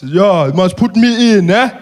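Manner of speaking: put-on slow, loud, heavy-accented; Malema impression. Malema greeting the comedian, slow and loud. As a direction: drag each word, teasing.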